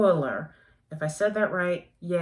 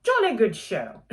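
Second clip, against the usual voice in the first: louder mock British cheer; British accent; 3 semitones higher, 5 dB louder than usual. Reviewer asking viewers to work with her, terrible British accent. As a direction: repeat the bad British accent with gusto. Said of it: "that was a terrible British accent".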